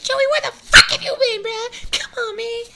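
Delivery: mock exasperated viewer. Imitating viewers who ask where Joey has been, mock exasperated. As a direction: whine it up high like a fed-up fan. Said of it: "'cause you're like".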